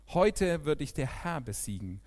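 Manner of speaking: lauter, kämpferischer Ausruf mit Druck auf Heute; laut ausgerufen. A narrator's reading. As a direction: ruf es dem Riesen laut entgegen. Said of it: "David rief zurück". (laut und kämpferisch ausgerufen)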